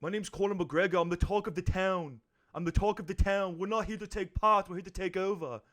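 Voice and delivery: put-on high Irish accent; Irish brogue, fighter bravado; Conor McGregor impression. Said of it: "can I try my Irish accent".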